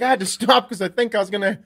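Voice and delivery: high, loud, building to a mock shout; hammed-up panicked wired dad; jacked up. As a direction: build to a hysterical comic yell. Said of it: "like, a little jacked up".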